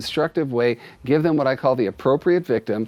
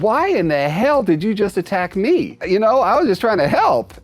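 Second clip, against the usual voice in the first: aggrieved owner; 6 semitones higher, 3 dB louder than usual. Acted. Owner voiced complaining to cat.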